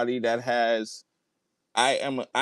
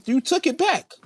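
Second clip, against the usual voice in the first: high-pitched; 12 semitones higher than usual. Real, not acted. Heated argument over the phone.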